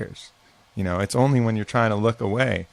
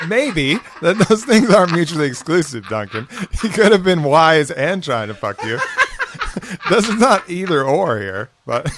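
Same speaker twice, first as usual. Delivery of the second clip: laughing; 8 semitones higher, 6 dB louder than usual. Real, not acted. Teasing friend, laughing at story.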